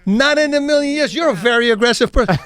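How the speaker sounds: loud, high-pitched disbelieving retort; mock incredulity. Fighter answering a man's seven times boast, mock incredulity. Retold incredulous reply to braggart.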